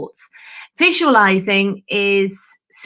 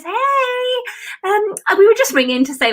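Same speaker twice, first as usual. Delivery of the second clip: much higher, chirpy mock caller; cheery caller; 13 semitones higher, 3 dB louder than usual. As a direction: sing out a bright hello like a happy neighbour. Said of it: "no one rings the police and says".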